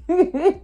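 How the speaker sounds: a short high burst of laughter; sudden real laugh. Real, not acted. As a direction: laugh out at your own story. Laughs at her own story.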